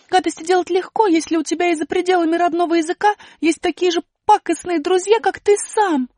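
высоко и громко, сквозь плач, медленно; злость вместо страха; злой окрик. Acted. Аля гневно думает. (злой высокий выкрик Али)

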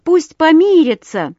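high, loud chorus demand; crowd calling out. A narrator's reading. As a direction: shout it as an approving crowd. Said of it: "крикнули все хором".

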